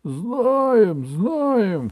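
high, loud impatient yell; crowd shouting. Acted. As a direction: shout it from the stands. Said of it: "кричали на трибунах".

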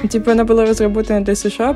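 usual speaking voice

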